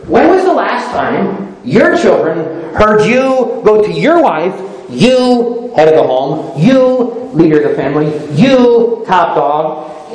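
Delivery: usual speaking voice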